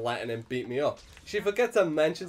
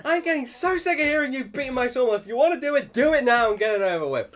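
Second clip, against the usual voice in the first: higher, louder scolding; angry mum; 11 semitones higher, 7 dB louder, faster than usual. Retold or imitated. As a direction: lay into the bully. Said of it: "she's like, proper".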